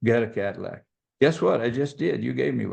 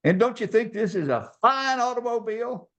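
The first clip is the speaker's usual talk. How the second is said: high, gushing imitation of a salesman; hammed-up salesman greeting, pitched way up; effusive car salesman; 11 semitones higher than usual. Salesman greeting the customer's dad on the lot, effusive. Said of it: "Salesman comes running out to the car".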